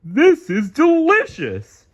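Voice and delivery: loud, high, dopey starfish voice; Patrick voice. A narrator's reading. Patrick guessing at SpongeBob's news, loud dopey voice. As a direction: ask eagerly and slow. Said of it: "Patrick declared".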